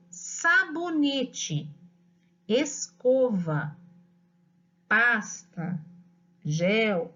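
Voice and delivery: each word drawn out slowly and whole, never split into syllables; slow whole-word dictation. Shown as a demonstration. Teacher dictating a word list to a child, slowly and whole. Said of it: "Eu falo a palavra inteira".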